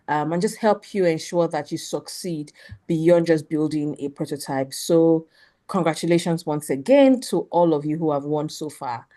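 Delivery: ordinary talk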